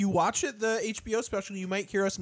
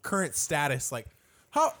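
normal talking voice

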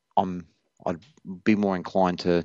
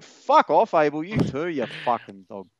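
swearing tirade; 6 semitones higher than usual. Real, not acted. Abusing another commenter.

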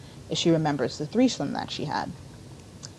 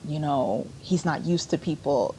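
ordinary talk

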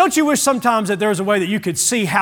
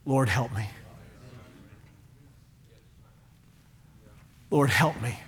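low, soft pleading; hushed humble cry for help; desperate prayer; 9 semitones lower, 10 dB quieter than usual. Retold or imitated. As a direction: pray it at his feet, barely aloud. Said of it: "all you can say is".

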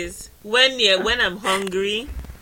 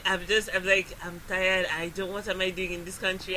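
low, soft, tired grumble; retold weary complaint; 4 semitones lower, 7 dB quieter than usual. Rider telling the bus driver of being tired, retold wearily. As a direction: imitate a worn-out, self-pitying moan.

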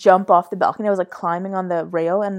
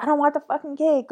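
higher-pitched mock complaint; mock protest; 7 semitones higher than usual. Teller quotes self refusing birthday cake, mock protest.

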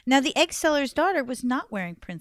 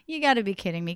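ordinary delivery, nothing marked

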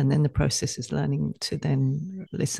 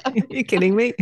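higher and louder, laughing it off; laughing disbelief; 7 semitones higher, 5 dB louder than usual. Pretend. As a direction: treat the insult as a joke. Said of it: "I'd be like".

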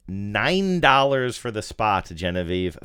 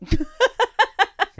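loud high laugh; 6 dB louder than usual. Real, not acted. Burst of laughter at quiz question.

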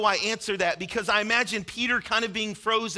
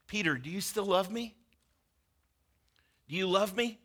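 softer, tender rising ask; gentle question; 4 dB quieter than usual. Acted. Jesus softly asking Peter if he still loves him.

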